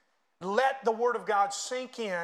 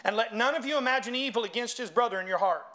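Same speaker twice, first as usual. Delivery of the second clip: raised, ringing scripture reading; preached scripture reading; 4 semitones higher, 5 dB louder than usual. Acted. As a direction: read scripture out forcefully to a congregation. Reads scripture aloud to congregation.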